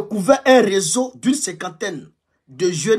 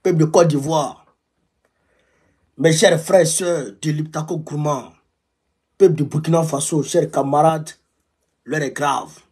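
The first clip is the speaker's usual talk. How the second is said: fiery revolutionary speech. Acted. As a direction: hammer out the alarm to comrades. Fiery address to African comrades.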